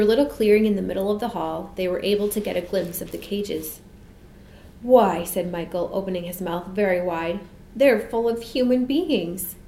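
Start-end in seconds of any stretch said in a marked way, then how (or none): 4.8-5.2 louder exclamation of amazement; taken aback; astonished. A narrator's reading. Boy amazed at the sight. 7.8-9.5 slow, higher-pitched amazement; amazed child's discovery; astonished. A narrator's reading. Boy amazed at the sight.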